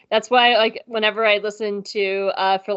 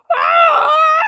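very high, loud mock scream; imitated scream; 8 dB louder than usual. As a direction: let out a piercing imitation screech. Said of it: "it just sounded like someone going".

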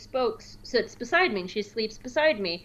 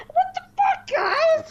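much higher, laughing and louder; laughing outrage; 4 dB louder, slower than usual. Retold or imitated. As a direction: squeal it at the cats in mock outrage. Scratched again, laughing outrage.

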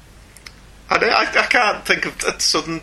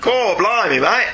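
higher, hammed-up Australian accent; mock Australian accent; 4 semitones higher, slower than usual.

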